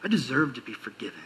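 smug entitlement. Retold or imitated. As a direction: savor your own entitlement.

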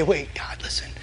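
hushed, trusting prayer with pauses; intimate appeal. Acted. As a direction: draw near and gently reason with God. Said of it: "in this intimate way".

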